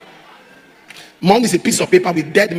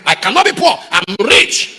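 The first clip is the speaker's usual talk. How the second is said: mocking proud rich man; 5 semitones higher than usual. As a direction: sneer it like a snob who looks down on the poor.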